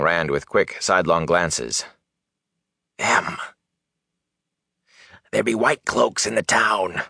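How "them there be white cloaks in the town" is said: raspy voice of a hunted peddler; hoarse, hushed. A narrator's reading. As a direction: warn him hoarsely, secretively. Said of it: "dropped into a hoarse whisper".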